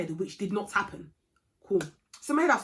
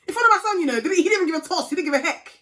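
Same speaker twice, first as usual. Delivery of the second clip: much higher and louder, indignant outburst; outraged; 9 semitones higher, 8 dB louder than usual. Real, not acted. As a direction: let the outrage burst out. Outraged at the officer.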